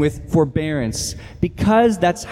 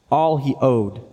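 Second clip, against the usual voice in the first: ordinary talk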